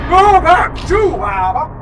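loud, high and slow shout. Real, not acted. Player shouting at a game figure, slow loud call. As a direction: bellow it across the room.

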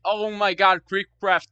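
ordinary talk, nothing marked

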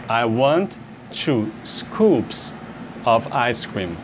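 very slow, each word spaced out; slow teaching repeat. Shown as a demonstration. Teacher slowing the phrase down for students. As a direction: spell it out slowly so students can copy. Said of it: "você diria".

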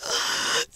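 choked breath in; sobbing gasp. A narrator's reading. Pat weeping in Judy's arms, gasping. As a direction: catch a sob. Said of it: "weeping wildly".

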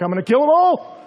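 high, loud mock war cry; a hammed-up furious shout; battle cry. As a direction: yell it riding off with his men. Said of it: "he goes riding off with his boys like".

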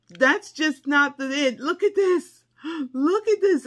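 high, loud burst of excitement; excited gushing. Real, not acted. Showing viewers the gifted sunglasses, excited gushing. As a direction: gush over a new find.

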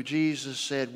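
ordinary talk, nothing marked

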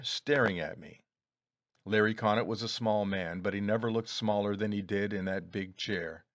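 ordinary delivery

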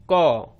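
कौ as k plus open 'aw'; blended syllable demo. Shown as a demonstration. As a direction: hold the vowel in कौ. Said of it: "तो बन गया".

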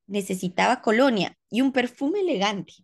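ordinary delivery, nothing marked

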